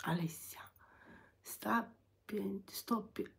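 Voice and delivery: softly whispered aside; barely voiced, under her breath. Real, not acted. Whispers while reading chat.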